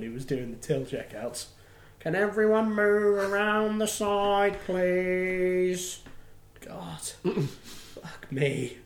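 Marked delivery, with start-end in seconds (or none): 2.0-6.0 slow, higher, drawn-out weary call; a fed-up till woman calling out; annoyed shop worker. Retold or imitated. 6.7-8.8 exasperated whisper under breath. Real, not acted. Exasperated aside.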